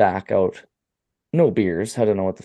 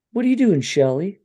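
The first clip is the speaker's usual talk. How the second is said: squeaky Shelley voice; 10 semitones higher than usual. Retold or imitated. Voicing Shelley in retelling.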